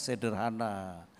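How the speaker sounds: ordinary delivery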